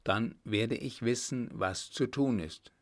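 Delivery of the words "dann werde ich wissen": ordinary delivery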